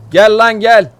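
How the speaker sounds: loud, high shout to the teammate; calling out. Real, not acted. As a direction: yell for Ossi to come. (takım arkadaşına bağırarak)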